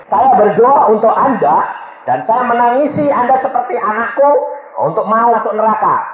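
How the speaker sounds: high, loud, insistent appeal; urgent confrontation. Retold or imitated. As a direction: press the woman to confess. Confronting the wife in retelling.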